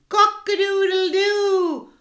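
loud high cock-a-doodle-doo; rooster crow. A narrator's reading. Cockerel ending the concert, loud crowing. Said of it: "crowed the cockerel".

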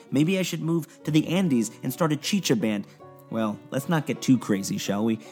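ordinary talk

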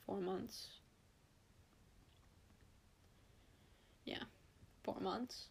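soft, nearly whispered grief; quietly choked up; real sorrow, hushed; tearful whisper. Real, not acted. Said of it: "I'm getting so emotional".

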